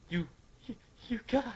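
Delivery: weak, lisping drowning voice; underwater mumbling. Acted. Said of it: "He's, like, mumbling".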